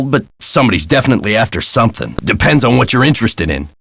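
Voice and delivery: usual speaking voice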